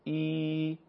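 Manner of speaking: ای held long, two beats; long drawn-out vowel. Shown as a demonstration. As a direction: stretch ای out as a long madd. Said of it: "با حالت کشیده میگین". (یاء مدی، ای کشیده)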